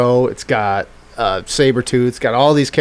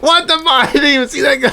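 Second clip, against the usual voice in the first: much higher, laughing "what the fuck"; shocked laughing outburst; 14 semitones higher, faster than usual. Retold or imitated. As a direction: blurt it out in disbelief, laughing. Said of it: "You're like".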